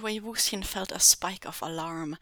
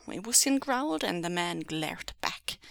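usual speaking voice

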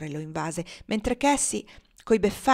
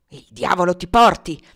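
loud, forceful outburst; angry curse; 10 dB louder than usual. A narrator's reading. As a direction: curse her out loudly. Legré curses Cassie. (imprecazione rabbiosa)

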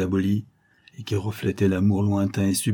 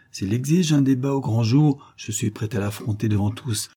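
ordinary delivery, nothing marked

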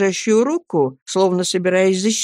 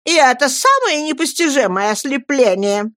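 higher-pitched scandalised outburst; indignant exclamation; 7 semitones higher than usual. A narrator's reading. Miss Murdstone decries her sister-in-law's blindness, exclaiming. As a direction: exclaim in disbelief. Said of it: "воскликнула мисс Мордстон".

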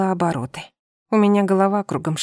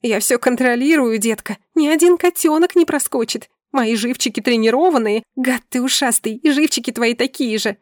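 heated, raised-pitch tirade; angry scolding; 4 semitones higher than usual. Acted. Wife in labour berates her husband, angry scolding. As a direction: throw his words back at him, indignant.

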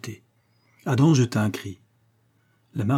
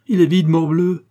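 raised, louder and exasperated; impatient retort; 7 semitones higher, 8 dB louder than usual. A narrator's reading. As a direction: snap back with impatience. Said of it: "répliqua le duc impatienté". (réplique impatiente, voix haute et forte)

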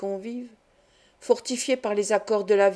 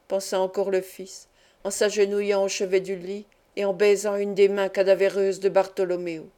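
usual speaking voice

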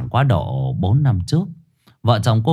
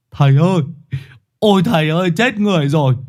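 wailing cry; 7 semitones higher, faster than usual. A narrator's reading. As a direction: cry out to the master in panic. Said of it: "Nó gào lên thống thiết".

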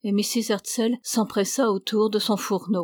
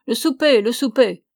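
raised voice, punched out twice; shouting; 3 semitones higher, 6 dB louder than usual. A narrator's reading. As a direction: call out like hungry men bursting in. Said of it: "se précipitèrent dans la cuisine en criant".

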